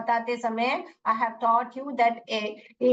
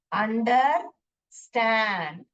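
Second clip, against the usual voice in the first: Understand stretched out syllable by syllable; slow spelling dictation; much slower than usual. Shown as a demonstration. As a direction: draw the word out for the student. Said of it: "US लिख लीजिए". (धीरे खींचकर बोलना)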